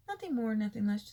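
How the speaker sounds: usual speaking voice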